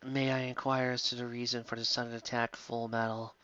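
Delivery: quiet, detached politeness; cool and aloof. A narrator's reading. Reader voicing the cool heroine asking Ed, coolly. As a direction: ask it coolly, unbothered. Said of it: "I asked coolly".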